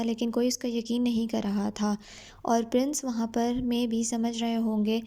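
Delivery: normal talking voice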